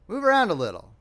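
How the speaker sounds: enthusiastic. A narrator's reading. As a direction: urge them on keenly. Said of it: "repeated Perry enthusiastically".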